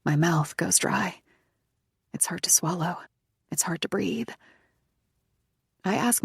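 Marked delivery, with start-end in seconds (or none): 2.1-4.3 frightened whisper, breath failing; 1 breathless whisper. A narrator's reading. Narrator losing her breath, whispered fear. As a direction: whisper it as panic tightens the throat. Panic, throat dry, can't breathe.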